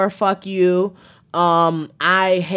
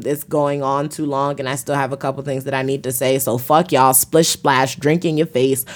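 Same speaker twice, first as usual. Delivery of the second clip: ordinary talk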